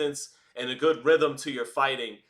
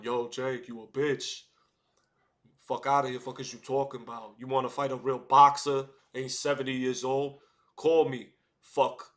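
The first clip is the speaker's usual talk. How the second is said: Edgar Berlanga; 2 semitones lower than usual. Acted. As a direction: do a classic Brooklyn fighter's call-out. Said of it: "the classic Brooklyn Edgar Berlanga one".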